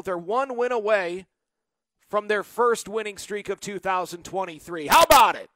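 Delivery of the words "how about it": loud, punchy cheer; celebratory exclamation. Real, not acted. Host celebrates being one win from a streak, exclaiming loudly. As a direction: burst out louder in triumph.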